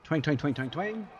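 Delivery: voiced twang sounds for arrows; mock bow twangs. Shown as a demonstration. Showing viewers the archers, twanging. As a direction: imitate bowstrings going twang. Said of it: "And watch this".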